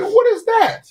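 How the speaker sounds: very high-pitched startled question; squeaky re-enacted astonishment; surprised, not yelling. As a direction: replay your surprise way up high without yelling. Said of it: "I didn't yell loud. I was like".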